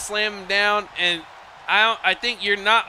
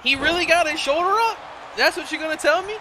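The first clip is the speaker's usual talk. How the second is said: high-pitched disbelieving questions; astonished, voice climbing at the end; incredulous; 7 semitones higher, faster than usual. Real, not acted. Disbelief at a kick out.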